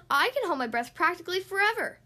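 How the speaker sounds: high, loud little brag; cocky young duck; boasting. A narrator's reading. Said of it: "boasted Thistle".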